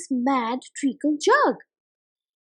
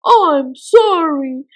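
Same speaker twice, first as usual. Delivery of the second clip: pleading cry; 9 dB louder than usual. Acted. Miggle begging Mother Tick-Tock to stop the jug. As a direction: beg for help in a panic.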